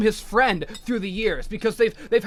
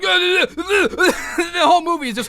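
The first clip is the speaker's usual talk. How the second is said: real laughter breaking the words, high and loud; laughing hard; 8 semitones higher, 7 dB louder than usual. Real, not acted. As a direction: lose it laughing mid-sentence. Laughing while recounting the film.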